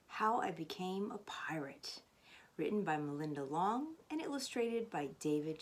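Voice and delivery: unvoiced hush on the title; whispered book title. A narrator's reading. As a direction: breathe the title like a secret.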